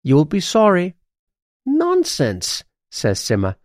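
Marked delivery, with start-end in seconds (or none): 0.0-1.0 higher, cautioning magpie; warning. A narrator's reading. Pet magpie warning the witch, higher and cautioning. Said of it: "warns Quip". 1.7-2.6 high, swooping dismissal; dismissive. A narrator's reading. Witch brushing off her magpie's warning, high and dismissive.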